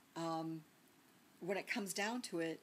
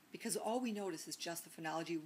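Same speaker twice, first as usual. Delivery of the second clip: ordinary delivery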